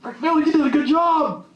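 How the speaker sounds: high, cheery mock praise from someone else; a speaker mimics outside approval in a high put-on voice; imitating a praiser. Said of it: "Validation is when somebody says".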